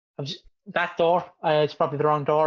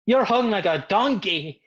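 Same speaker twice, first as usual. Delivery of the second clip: mock Regal; 3 semitones higher, slower than usual. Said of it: "And Regal goes".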